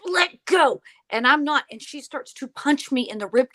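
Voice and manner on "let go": higher, louder two-word outburst; imitated demand. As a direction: imitate her demanding release, each go pushed out. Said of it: "and she's going".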